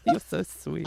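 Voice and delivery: high, slow doting coo; mock mom. Comic skit, mom cooing at bully son. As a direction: coo it like a proud mum.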